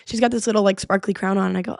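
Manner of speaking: ordinary talk, nothing marked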